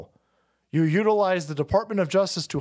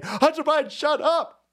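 mock press secretary; 11 semitones higher than usual. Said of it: "she's saying".

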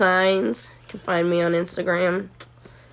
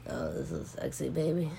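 much lower, soft hammed-up sultry voice; mock sexy voice; 3 semitones lower, 9 dB quieter than usual. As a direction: ham up a raspy seductive purr. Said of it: "raspy and sexy".

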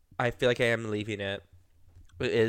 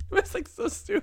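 laughing. Real, not acted. Laughing at the memory.

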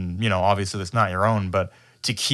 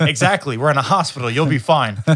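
louder, higher, hammed-up threat; mock gunman threat; 5 semitones higher, 6 dB louder than usual. Retelling a movie stickup at a nurse, loud mock threat. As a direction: push it out like an exasperated gunman.

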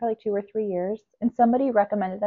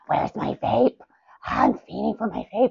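vape voice. Retold or imitated. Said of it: "imitates her vape voice".

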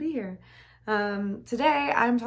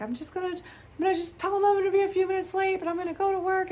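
high-pitched call across the flat; retold call to roommate Bree, much higher; 12 semitones higher, faster than usual. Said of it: "scream into my roommate".

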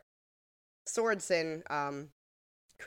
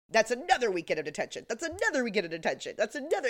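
faster, higher each time; escalating mock repetition; 6 semitones higher, 3 dB louder, faster than usual. Mimicking the Breakfast Club, rising mock escalation. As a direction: ramp it up like a detention chant. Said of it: "Breakfast Club where he's like".

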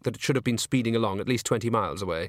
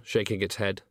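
plain reading voice

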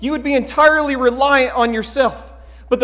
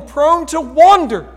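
louder, slow and drawn out; emphatic hymn line; 5 semitones higher, 9 dB louder, slower than usual. Acted. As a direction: lean hard into the words.

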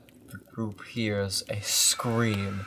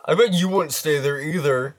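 put-on higher, louder cocky teen taunt; cocky teen boy; 6 semitones higher, 13 dB louder, faster than usual. Voicing Jason.

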